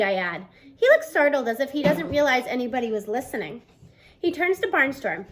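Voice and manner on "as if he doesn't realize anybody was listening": plain reading voice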